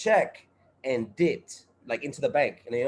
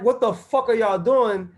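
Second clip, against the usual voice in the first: indignant; 7 semitones higher, 5 dB louder than usual. Retold or imitated. Confronting employers, retold.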